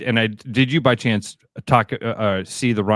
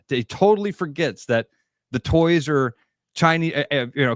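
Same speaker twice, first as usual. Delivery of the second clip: ordinary talk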